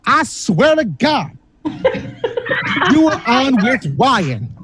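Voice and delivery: slow, drawn-out accusing tone; slightly slow imitation of her; her tone in my head. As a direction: drag it out like she's accusing you. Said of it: "this is the tone of her voice in my head".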